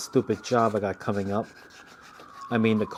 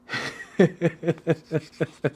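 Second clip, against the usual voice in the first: real amused laugh, higher pitch; 6 semitones higher, 3 dB louder than usual. Real, not acted. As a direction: laugh at the memory. Laughing at own story.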